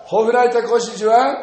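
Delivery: high, loud proclamation; loud scripture declaration. Acted. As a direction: declare it loudly for all to hear. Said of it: "大声で言った".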